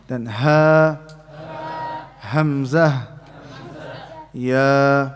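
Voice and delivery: ha, hamza, ya each said alone and clearly; recited letters. Shown as a demonstration. Teacher finishing the alphabet for students. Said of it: "pronounce them correctly".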